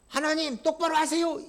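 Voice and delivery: mocking order, 똑바로 stressed; 똑바로 said as a cheeky mocking demand; insolent demand. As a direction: sneer 똑바로 at God. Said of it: "이따위 식으로 나갑니다".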